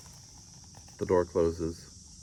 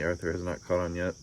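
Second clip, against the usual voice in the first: usual speaking voice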